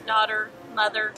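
normal talking voice

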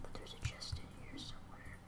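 hushed whisper. Real, not acted. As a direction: whisper it sneakily. Sneaking for a hidden chest.

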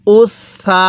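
উস সা with a long held s; doubled consonant. Shown as a demonstration. Teacher answering how to read it, doubled s held long. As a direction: hold the s from উস into সা. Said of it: "কীভাবে পড়ব?".

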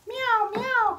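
very high, loud meowing; cat's meow. A narrator's reading. Storyteller voices a cat for children, high meows. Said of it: "cried the cat".

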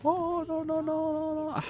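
very high squeaky no's; high panic. Real, not acted. Caught by the enemy.

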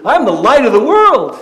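loud, high mock declaration; hammed-up loud boast; grand claim. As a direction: boom it out like a crazy claim. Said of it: "if I stood up here and told you".

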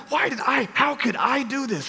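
high, exasperated self-reproach; retold self-anger. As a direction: re-enact being mad at yourself. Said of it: "I'm mad at me".

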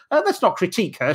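ordinary talk, nothing marked

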